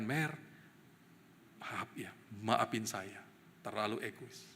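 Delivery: quiet apology to the wife. Retold or imitated. Retold apology to his wife.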